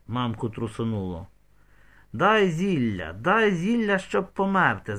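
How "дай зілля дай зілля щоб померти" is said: raised, drawn-out plea, much higher; crying out. A narrator's reading. Raisa demands a deadly potion, crying out. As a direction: cry out desperately, understated in volume. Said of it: "закричала Раїса".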